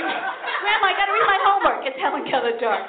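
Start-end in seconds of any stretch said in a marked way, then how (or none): 0.0-1.9 high, laughing plea to grandma; whiny kid pleading. Retold or imitated. Child whining to grandma for reading light. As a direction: whine like a child begging for light.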